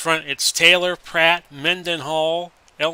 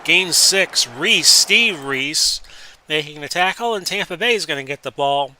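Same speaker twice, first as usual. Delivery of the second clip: louder, quicker sports call as the play unfolds; 2 semitones higher, 5 dB louder than usual. Acted. Announcer to viewers, live play-by-play.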